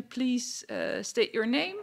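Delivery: ordinary talk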